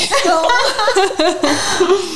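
high teary laugh; tearful laugh. Real, not acted. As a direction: laugh through tears. Tearful laugh after emotional moment.

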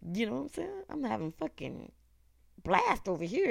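usual speaking voice

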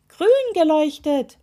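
high, loud contradiction; insistent. A narrator's reading. Toe insisting to the witch it glowed, high protest. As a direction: press the point excitedly. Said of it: "widersprach der Zeh".

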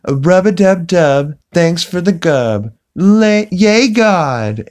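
lilting high mock prayer, drawn out; sing-song it like a silly grace; high, slow singsong prayer. LaToya saying a prayer before negotiations, high singsong. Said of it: "she's like".

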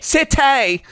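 high-pitched stage call. Acted. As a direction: echo a high frontman cry. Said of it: "[name]'s always gonna talk".

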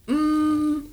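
high squeaky drawn-out words; guinea pig voice. Real, not acted. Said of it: "You sounded like a guinea pig".

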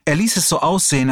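ordinary delivery, nothing marked

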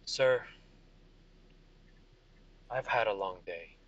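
low, weary general; tired soldier. Acted. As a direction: sigh through a long shift. GM plays tired Vega.

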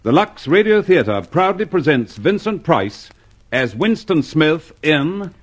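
formal, slow-paced radio introduction; measured announcer read. Acted. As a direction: present the show grandly to listeners.

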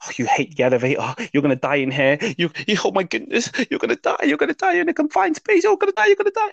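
the fear voice winding itself up into panic; panicking subconscious. Retold or imitated. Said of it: "subconscious starts talking to him".

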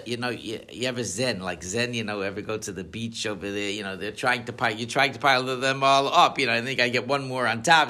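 ordinary talk, nothing marked

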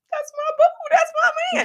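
high, loud gushing over her man. Retold or imitated. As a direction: squeal it with delight. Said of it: "she is waking up thinking".

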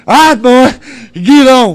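loud, slow, high order; loud command. Retold or imitated. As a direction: bark the order at the boy. Ordering son onto the calf.

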